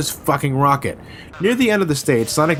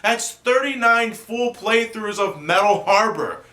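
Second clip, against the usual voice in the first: high-pitched, elated victory cry; triumphant outburst; 11 semitones higher, slower than usual. Real, not acted. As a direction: celebrate a hard-won success, high and drawn out. Finally wins after many attempts.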